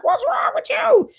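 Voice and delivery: high, slow imitation of the daughter's worried question; high, drawn-out mock of a worried kid; daughter asking. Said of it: "And my daughter was like".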